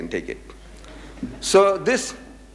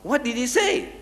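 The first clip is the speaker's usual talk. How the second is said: higher pitched, pushy pressing; impatient demand; 9 semitones higher than usual. Retold or imitated. Reverend demanding the Maulana's answer, impatient. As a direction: hurry him along impatiently. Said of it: "again started stamping".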